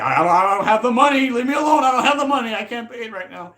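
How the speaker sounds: flustered yelling while fleeing; panicked brush-off. Retold or imitated. Peter dodging the landlord, high panicked yell. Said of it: "fling, like".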